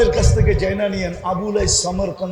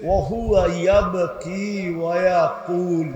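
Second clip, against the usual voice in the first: ordinary delivery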